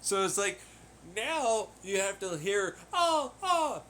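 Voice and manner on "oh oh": high, hammed-up fake moans; mock moaning. Hearing the neighbours through the moon, mock moaning. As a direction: ham up a silly moan, much higher. Mimicking moon sex noises.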